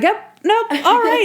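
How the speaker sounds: high-pitched, hurried blocking act; mock interrupting. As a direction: cut a suitor off in a high voice. Natalie cutting off Clay, acted.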